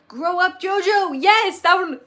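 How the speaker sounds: excited cheer. Real, not acted. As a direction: cheer the title out. Excited cheer at episode title.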